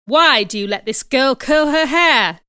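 loud, indignant demand; angry question. Acted. Mister Brocklehurst challenging the teacher about a pupil's hair, angrily. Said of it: "he asked angrily".

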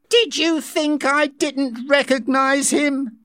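high, slow indignant woman's voice. A narrator's reading. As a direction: sound offended she was doubted. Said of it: "Linda asked indignantly".

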